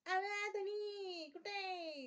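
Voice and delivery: high, long-drawn call for her husband; rustic village woman. Acted. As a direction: call out like a village wife, stretching the words. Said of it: "एकदम गावंढळ बाई". (गावंढळ बाईची उंच, लांबवलेली हाक)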